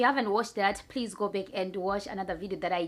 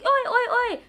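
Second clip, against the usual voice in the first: very high, loud mock hailing; loud, high-pitched imitation of shouting across; imitated calling out; 9 dB louder than usual. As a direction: mimic a piercing call to a friend. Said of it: "That's how they call each other".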